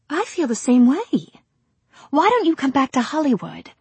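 put-on high, bright young actress. Rosemary speaks.

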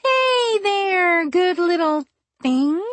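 high sing-song coaxing. A narrator's reading. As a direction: coo it like to a pet. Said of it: "in a sing-song voice".

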